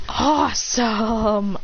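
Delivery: very drawn-out "awesome"; awed delight. Acted. Boy receiving a famous sword, slow drawn-out delight. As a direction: stretch every syllable in acted awe. Hero gets a new sword.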